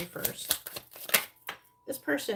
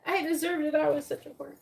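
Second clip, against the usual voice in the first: much higher, loud, sarcastic fake apology; mock groveling apology; 10 semitones higher, 8 dB louder than usual. Mocking what the friends expect her to say, a loud high grovel. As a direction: ham up a pathetic grovel. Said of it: "want you to come and be like".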